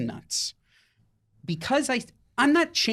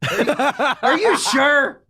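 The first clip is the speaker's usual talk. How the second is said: higher, louder, laughing through it; laughing disbelief; 7 semitones higher, 5 dB louder, much slower than usual. Real, not acted. Disbelieving outburst while laughing.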